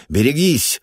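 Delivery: sharp, high warning shout. Acted. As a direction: yell it as a warning. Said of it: "крикнул я".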